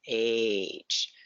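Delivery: "H" drawn out to expose its opening A sound; letter-name demo. Shown as a demonstration. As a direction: stretch "H" so the "ay" at the start stands out. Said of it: "that's the sound we hear".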